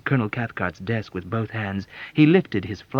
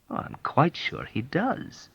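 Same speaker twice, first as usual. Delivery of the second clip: slow, higher and acidly polite; mild acid sarcasm; acid reply; 8 semitones higher, 3 dB quieter, slower than usual. Acted. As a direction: drip sarcasm over each word. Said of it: "replied acidly".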